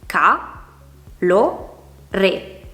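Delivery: CA, LO, RE with pauses between; separate syllables. Shown as a demonstration. Teacher dividing calore into three syllables, each said apart. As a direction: spell out ca, lo, re one beat at a time. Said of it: "ci sono tre sillabe".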